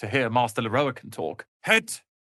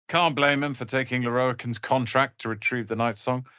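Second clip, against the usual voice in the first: ordinary delivery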